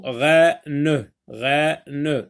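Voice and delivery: "reine" split into rei... ne, final e voiced; syllable by syllable. Shown as a demonstration. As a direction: say "reine" as two beats, rè, then neu. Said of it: "dans la première syllabe".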